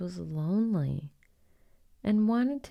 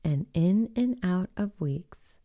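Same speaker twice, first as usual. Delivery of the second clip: plain reading voice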